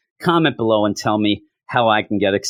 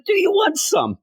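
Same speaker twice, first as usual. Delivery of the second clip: much higher, hammed-up cheeky offer; cheeky quip. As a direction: toss it off as a cocky joke. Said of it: "when I responded".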